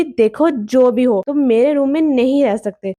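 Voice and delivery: plain reading voice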